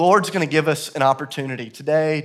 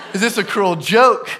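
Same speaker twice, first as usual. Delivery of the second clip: higher, louder, slow comic protest; incredulous complaint; 5 semitones higher, 5 dB louder, slower than usual. Retold or imitated. Friend asks God about the squash gift, incredulous. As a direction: demand an answer in mock exasperation.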